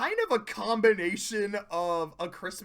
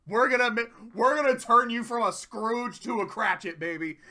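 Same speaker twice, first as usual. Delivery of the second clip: excited promise; 4 semitones higher, 6 dB louder than usual. Retold or imitated. Twilight eagerly promises to reform Starlight.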